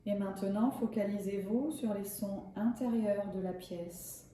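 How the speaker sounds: calm, measured yoga nidra guidance; unhurried relaxation voice with spacious pauses; guided meditation. Acted. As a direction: guide them gently into rest, leaving long pauses. Leads a yoga nidra session.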